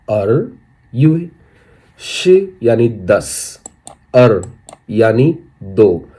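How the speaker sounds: अर युए and शी drawn out slowly, syllable by syllable; slow breakdown. Shown as a demonstration. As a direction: break the month name into slow parts. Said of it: "कैसा कहेंगे?". (धीरे धीरे तोड़कर)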